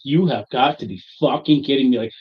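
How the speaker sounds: exasperated outburst. Retold or imitated. Husband to wife over a free store bag, loudly exasperated. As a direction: start incredulous, then stretch the last words out loudly.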